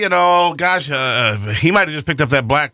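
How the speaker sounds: a hammed-up hand-wringing excuse; mock dithering voice. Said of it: "They didn't say".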